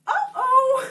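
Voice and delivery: high, loud comic uh-oh; sudden dread, played for laughs; mock alarm. Retold alarm at accent request.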